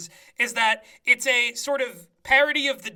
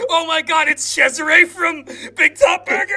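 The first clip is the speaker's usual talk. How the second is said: excited shout; 5 semitones higher, 9 dB louder than usual. Real, not acted.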